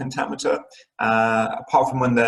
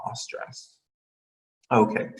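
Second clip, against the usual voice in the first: ordinary talk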